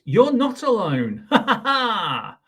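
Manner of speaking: high, slow hammed-up laughing; mock laughter. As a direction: cackle like a cheeky cartoon. Book's character laughs.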